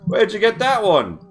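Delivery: a hint of a tipsy Cockney chap; British rogue voice. GM voicing a tipsy hatter greeting the party, British.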